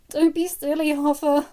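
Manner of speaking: high, teary; sniffling. Acted. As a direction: sniff it through tears. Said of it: "she sniffed loudly".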